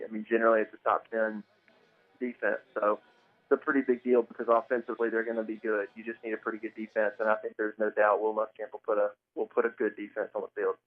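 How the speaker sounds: ordinary talk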